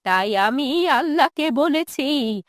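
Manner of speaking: bitter, quavering lament of a wronged mother; wounded old woman, tearful and resentful; aggrieved old mother. Acted. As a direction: pour out the old grievance, stretching phrase ends.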